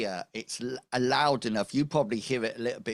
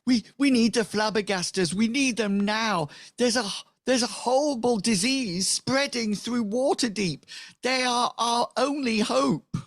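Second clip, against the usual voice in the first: high, loud frantic plea; hammed-up panicked villager voice; desperate villagers; 13 semitones higher, 5 dB louder than usual. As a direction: beg for help in a shrieky falsetto. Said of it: "they said".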